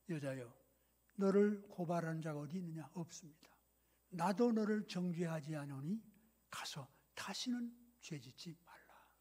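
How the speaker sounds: soft, low, merciful reassurance; gentle, feeling words. Acted. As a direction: release the accused softly, without condemning. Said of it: "감성 대화잖아요". (나지막하고 자애로운 말투)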